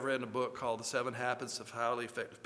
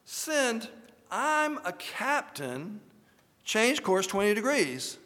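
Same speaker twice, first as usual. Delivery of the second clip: commanding captain; 6 semitones higher, slower than usual. A narrator's reading. Captain orders the other ship.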